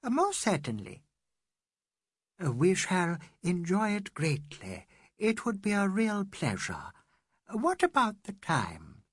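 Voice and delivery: a lightly voiced gracious Englishwoman, slow. A narrator's reading. Mrs Moore thanking her host, slow and gracious.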